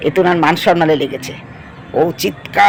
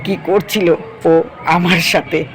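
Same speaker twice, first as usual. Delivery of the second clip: normal talking voice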